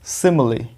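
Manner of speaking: सिमिली with short "i" sounds; model pronunciation. Shown as a demonstration. Teacher shows students the word, carefully pronounced सिमिली. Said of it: "इसी को देख के मैं पढ़ रहा हूँ".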